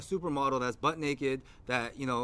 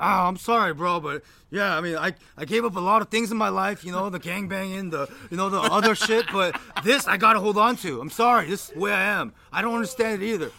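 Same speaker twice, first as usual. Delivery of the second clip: loud, higher-pitched mock exasperation; imitated impassioned apology; 5 semitones higher, 7 dB louder than usual. Friend defending his love of sports, imitated as impassioned. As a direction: play the worked-up friend pleading his case. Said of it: "being so passionate about it now".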